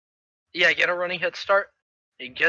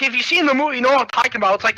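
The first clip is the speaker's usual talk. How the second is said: usual speaking voice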